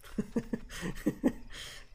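quiet breathy giggle; airy chuckle at own joke; breathy laugh. Real, not acted. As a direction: laugh it off softly. Laugh after mock brag.

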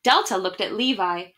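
usual speaking voice

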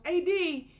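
calling out a name loud and high; a mock call across a crowded room; retold yell. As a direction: shout her name across the bar. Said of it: "just yells out to everybody".

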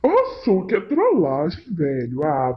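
ordinary talk

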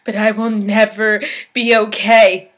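loud, forceful declaration; emphatic despair. Acted. Patient admits they will never recover, loud and emphatic. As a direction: hit NEVER hard, with full voice. Forceful admission, then hushed repeat.

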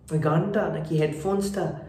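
ordinary delivery

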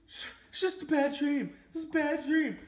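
put-on high, slow, shaken girlfriend voice; imitating scared girlfriend. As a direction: play her half-awake and rattled. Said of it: "She goes".

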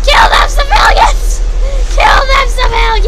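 loud, high joking war shout; mock battle cry. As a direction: yell it like a silly rallying cry.

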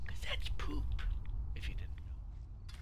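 conspiratorial whisper, slowing at the end; whispered aside. Real, not acted. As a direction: share a gross secret in a whisper. Hushed aside to viewers.

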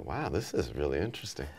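low, soft aside; musing to oneself. Retold or imitated. Drummer overhearing talk at dinner, musing quietly to himself. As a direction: think it quietly to yourself, intrigued. Inner reaction while overhearing a conversation.

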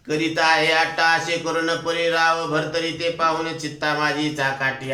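ordinary delivery

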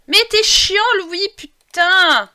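loud, high annoyed outburst; exasperated scolding. Real, not acted. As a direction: snap loudly at a nuisance. Scolding the Sim Louis.

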